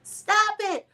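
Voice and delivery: hammed-up stop it, high; mock outrage at being sprayed with water; mock furious. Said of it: "She was furious".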